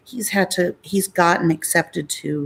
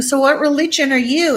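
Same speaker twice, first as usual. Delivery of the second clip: bubbly flirty question; 10 semitones higher, 5 dB louder than usual. Retold or imitated. Asking in the pool.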